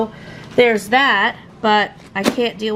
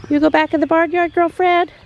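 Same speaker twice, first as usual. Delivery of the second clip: coaxing; 7 semitones higher than usual. Real, not acted. Talking sweetly to cow.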